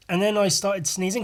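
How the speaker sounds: ordinary delivery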